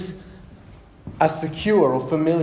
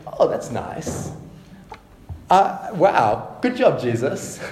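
mock lukewarm; 3 semitones lower, slower than usual. Mock unimpressed reaction.

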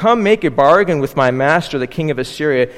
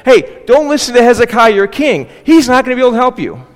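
taunting shout; 7 semitones higher, 6 dB louder than usual. Retold or imitated. Paraphrased Rabshakeh taunting the people.

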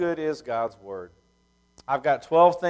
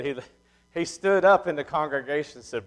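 ordinary talk

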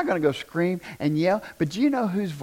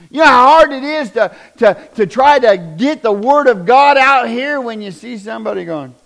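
louder, higher preaching; 8 semitones higher, 11 dB louder than usual. Real, not acted. Preacher chiding the congregation, raised voice. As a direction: push it out to the crowd.

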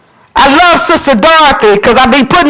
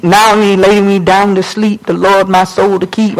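low, monotone rote recitation; childish bedtime prayer; 6 semitones lower than usual. Acted. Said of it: "We wanna still pray these".

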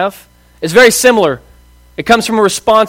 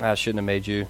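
low, much softer and heavy with letdown; a father sighing over a failed son; disappointed dad; 10 semitones lower, 14 dB quieter than usual. Retold or imitated. God pictured as a disappointed dad, speaking low and soft. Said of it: "this disappointed dad".

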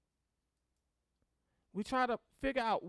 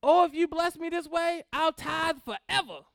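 higher, louder mock plea to God; hammed-up Jacob pleading; 7 semitones higher, 7 dB louder than usual. Preacher imitates Jacob bargaining with God, hammed-up pleading. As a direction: beg for a blessing, promising big. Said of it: "like Jacob".

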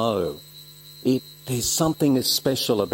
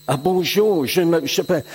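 mock French; 5 semitones higher than usual. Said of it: "they're all speaking other language".

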